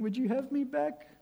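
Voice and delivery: high, unsure little voice asking to come back; a hint of a nervous, pleading sinner; timid pleading. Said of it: "Not groveling, kind of going".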